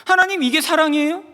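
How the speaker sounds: high, indignant challenge; retold anger. Husband questioning God's love, acting out his anger. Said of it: "자꾸, 자꾸 화가 나는 거예요".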